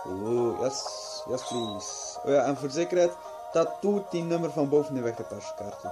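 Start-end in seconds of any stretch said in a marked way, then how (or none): none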